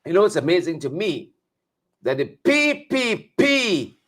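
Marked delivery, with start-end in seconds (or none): 2.2-4.0 higher, very slow, drawn-out P P P; Auntie Sucky voice. Retold or imitated. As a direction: drawl each letter out like a mocking auntie. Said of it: "in my Auntie Sucky voice".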